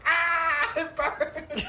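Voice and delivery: high, loud cry of pain, half laughing. Retold or imitated. Friend retelling Frank in pain, crying out loud. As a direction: yell it like the water burns. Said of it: "Frank's just like".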